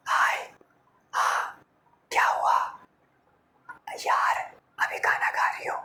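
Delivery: breathy hushed whisper into the phone; soft whisper. Retold or imitated. Girlfriend whispering on the phone so mom won't hear. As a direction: whisper so nobody nearby hears. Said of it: "वो थोड़ा धीरे धीरे बोल रही है".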